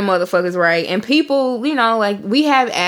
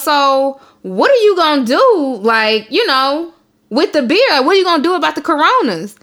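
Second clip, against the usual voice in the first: imitating auntie; 6 semitones higher than usual. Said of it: "she goes".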